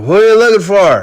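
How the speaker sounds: put-on quick, high, loud drunken holler; imitated drunken shout; drunk man calling out. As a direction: blurt it like a drunk on the ground. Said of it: "a drunk guy who's, like, on the ground".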